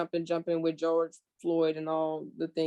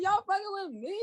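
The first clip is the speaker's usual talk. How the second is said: high, laughing incredulous repeat; milder disbelief; 13 semitones higher than usual. Retold or imitated. Repeating her reaction, milder high disbelief. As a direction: repeat it still amazed. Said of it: "I'm like".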